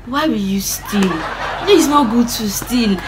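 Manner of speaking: slow, sing-song mocking mimicry; annoying sister. Sister lecturing the culprit, slow mocking imitation. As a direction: drag out a preachy sibling's reproach.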